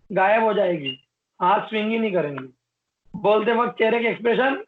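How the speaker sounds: usual speaking voice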